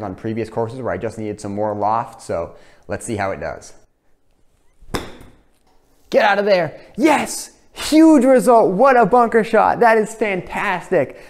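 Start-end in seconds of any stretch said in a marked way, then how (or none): 6.1-11.2 high, loud cheering; excited shout. Real, not acted. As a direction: yell it out, thrilled. Bunker shot comes out great.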